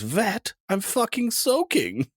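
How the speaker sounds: German man. Retold or imitated. German rescuer answering the dog owner, high and slow. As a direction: squeal the punchline like the soaked rescuer. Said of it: "And he goes".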